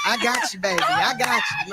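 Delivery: playful, very high falsetto; flirty alter ego. Acted. Wigged woman reassures her man, flirty falsetto. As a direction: squeal it flirtatiously in a put-on high voice.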